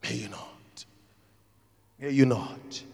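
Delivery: unvoiced, hushed prayerful whisper; soft whispered echo. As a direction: breathe it as a whispered blessing. Whispered repetition of blessing.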